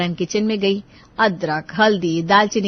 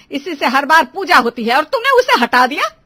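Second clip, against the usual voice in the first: raised, loud angry reproach; indignant scolding; 4 semitones higher, 7 dB louder than usual. Acted. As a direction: rebuke building in pitch and volume. Mother-in-law scolds the bride. (नाराज़ सास की ऊँची फटकार)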